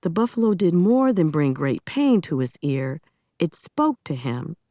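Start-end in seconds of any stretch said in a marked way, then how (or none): none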